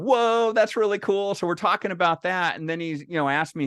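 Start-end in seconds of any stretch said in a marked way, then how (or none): 0.0-1.3 impressed stranger. Retold or imitated. Guy at the bar reacting to a Tesla purchase, impressed. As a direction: sound genuinely wowed by a new Tesla. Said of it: "And he's, like, going".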